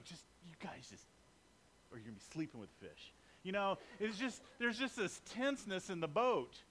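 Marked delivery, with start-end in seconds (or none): 0.0-3.1 low, fast, hushed gangster threat; mock mobster threat. Peter muttering a mob threat at the grumbling crew. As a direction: growl it quickly under your breath like a mob boss. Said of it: "he's like".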